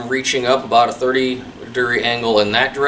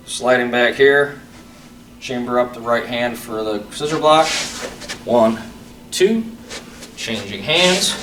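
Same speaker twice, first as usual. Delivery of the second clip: crisp called-out form commands; sharp martial arts commands; 4 dB quieter than usual. Shown as a demonstration. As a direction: call each move like a drill. Calls form movements over music.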